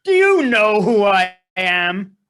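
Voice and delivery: loud, slow, indignant challenge; indignant demand. Acted. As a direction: pull rank loudly, word by word. Angry passenger pulls rank on agent.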